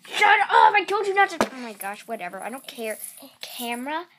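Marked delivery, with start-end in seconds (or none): 0.1-1.4 loud, annoyed shout at her brother; angry yell. Real, not acted. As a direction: snap at him to stop. Yelling at brother moving camera.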